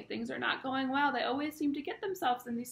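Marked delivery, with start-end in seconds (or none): none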